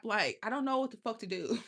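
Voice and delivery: normal talking voice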